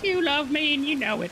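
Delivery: high put-on horse voice; high-pitched talking animal. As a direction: tease your rider in a high horse voice. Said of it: "This is the voice I come with".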